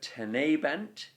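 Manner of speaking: "tenebant" with a strong, long "ne"; te-NE-bant, demonstrating the stress; stress on middle syllable. Shown as a demonstration. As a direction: lean on the "ne" in "tenebant". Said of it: "The stress is therefore on the tene".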